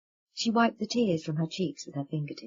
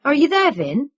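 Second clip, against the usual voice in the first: calls across the workshop, louder; 朝工作间里喊一声, 提高音量; calling out; 6 semitones higher, 8 dB louder than usual. A narrator's reading. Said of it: "he called".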